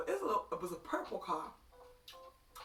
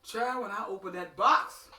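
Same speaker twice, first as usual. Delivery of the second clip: excited, loud; 9 dB louder, slower than usual. Real, not acted. Excited retelling of gift.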